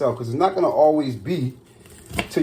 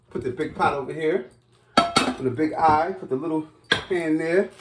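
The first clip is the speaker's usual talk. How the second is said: normal talking voice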